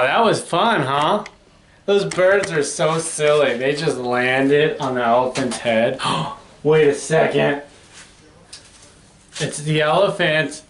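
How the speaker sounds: ordinary delivery, nothing marked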